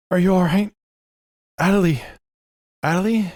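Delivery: high, loud, worried call into the dark; frightened calling out. Acted. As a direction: call out for a lost friend, scared. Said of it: "and he's like".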